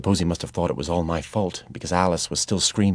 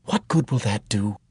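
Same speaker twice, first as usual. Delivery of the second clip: Ellie pleading; 4 semitones higher than usual. A narrator's reading.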